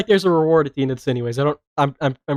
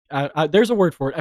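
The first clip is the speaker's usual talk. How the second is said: ordinary talk, nothing marked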